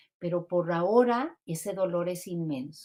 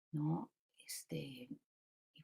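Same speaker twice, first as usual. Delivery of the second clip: whispered aside; 3 semitones lower, 12 dB quieter, much slower than usual. Real, not acted. Soft aside after closing thought.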